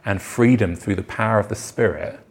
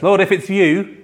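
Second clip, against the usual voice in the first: eager call; 9 semitones higher, 5 dB louder than usual. Retold or imitated.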